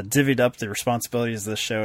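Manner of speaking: ordinary talk, nothing marked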